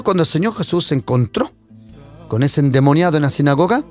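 normal talking voice

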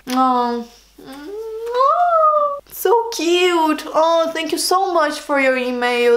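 high, gushing squeal of delight. Real, not acted. Vlogger thanking a fan for a sweet email, gushing delight. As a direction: coo it like the email just melted you. Touched by a viewer's kind email.

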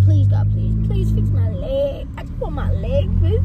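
loud hammed-up prayer to God; mock prayer. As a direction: beg God dramatically. Mock prayer for her leg.

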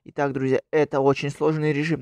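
loud energetic channel greeting; YouTuber intro. Acted. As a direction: hype up the viewers. Вступление к видео. (бодрое приветствие ютубера)

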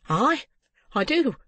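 high, firm retort; defiant girl. A narrator's reading. Girl meeting the old man's gaze, defiant. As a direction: answer back high and unflinching.

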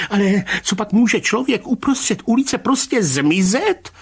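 high-pitched, aghast protest; horrified. A narrator's reading. Rybka appalled that a man can vanish, high horrified voice. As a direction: ask it high, appalled and incredulous. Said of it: "Hrozil se pan Rybka".

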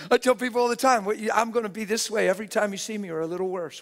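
loud, high, fired-up preaching; exuberant shout. Real, not acted. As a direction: belt it out to the congregation. Exuberant preaching after laughing.

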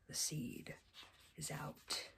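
hushed, breathy reveal; whisper. A narrator's reading. Mom whispering the reveal to the child. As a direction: whisper it like a secret.

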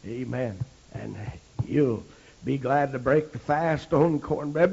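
usual speaking voice

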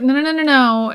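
ordinary talk

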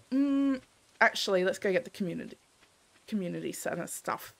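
usual speaking voice

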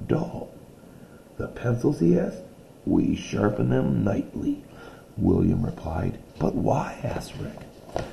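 reading a picture book aloud to a class; steady read-aloud storytelling voice; story narration. A narrator's reading. Teacher reading a story to her class, read-aloud voice.

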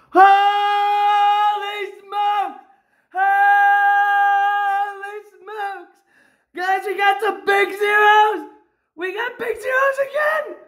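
high, long-held celebratory hollering; excited shouting. Real, not acted. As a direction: stretch out the yell in sheer amazement.

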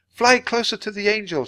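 high, loud command in flight; shout. A narrator's reading. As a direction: shout an urgent direction. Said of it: "shouted Annie".

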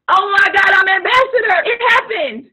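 loud, high, overjoyed "Oh my God"; a mock dreamer celebrating success out loud; ecstatic shout. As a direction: shout it like you just won.

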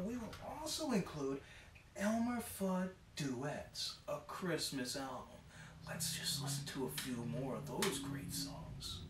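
ordinary talk, nothing marked

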